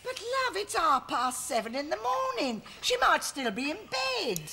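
alarmed and pleading, pitched well up; shocked protest. Acted. Mum protesting to her son, shocked and high.

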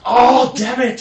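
frustrated shout. Real, not acted. Frustrated gamer outburst.

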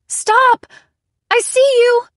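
high, loud desperate yell; frantic shout. A narrator's reading. Narrator shouts at the intruder on the landing, frantic. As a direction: scream at the intruder to stop.

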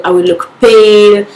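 usual speaking voice

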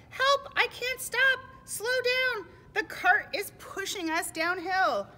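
high, loud, drawn-out alarm; hammed-up storybook panic; panicked cry. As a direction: cry for help on a runaway cart. Animals panic as cart runs away.

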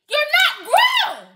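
high, loud and slow; slow scolding. Real, not acted. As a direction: spell it out like a stern parent. Scolding a child.

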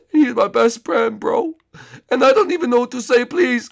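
high, loud begging bro; high pleading dude. Acted. Friend begging viewers in a high, loud voice.

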